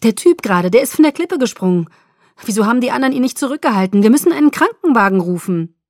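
loud, high, rushed alarm; frantic cry for help; panicked. Acted. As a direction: blurt it out urgently in panic. Panicked report of a cliff jump.

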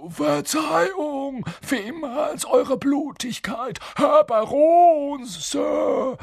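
very high, slow, oily grovelling; slimy. A narrator's reading. Peeves apologising to the supposed baron, slimily obsequious. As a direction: draw it out in fawning servility. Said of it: "sagte er schleimig".

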